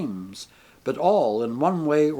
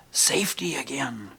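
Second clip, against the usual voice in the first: unvoiced hushed mutter, close to the ear; 4 dB quieter than usual. A narrator's reading. Man on bus mutters warning to narrator, hushed whisper. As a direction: breathe it as a secret. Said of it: "he muttered".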